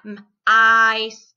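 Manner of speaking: M, then ice, pulled apart; segmented word. Shown as a demonstration. Teacher sounding out a word for students, segmented.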